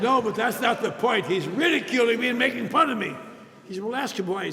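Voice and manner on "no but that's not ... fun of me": much louder and higher, heated; heated protest to his sponsor; indignant protest. Retold or imitated. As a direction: protest like he's missing the point. Arguing with sponsor.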